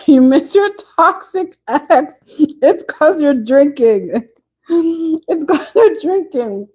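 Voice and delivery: slow, giggling tease; teasing through laughter. Real, not acted. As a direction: laugh at her while you tease her. Teasing a tipsy viewer.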